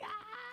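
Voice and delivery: soft high mock scream; small squealed "ah" of pretend horror. Guest recoiling from joining her older sister's university, mock scream.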